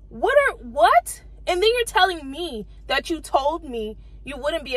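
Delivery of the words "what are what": high, slow, baffled what; stunned, can't believe it; disbelief. Real, not acted. Vlogger baffled by the stylist's excuse, high and slow.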